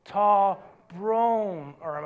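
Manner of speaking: drawn-out Irish apology, low; slow lead-along. Shown as a demonstration. Preacher teaches congregation Irish sorry, slowly. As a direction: stretch each word for them to follow.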